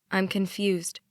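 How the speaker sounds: ordinary delivery, nothing marked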